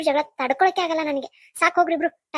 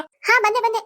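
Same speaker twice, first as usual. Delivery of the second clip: calling back; 6 semitones higher, 5 dB louder, slower than usual. Acted. Mother calls back from kitchen.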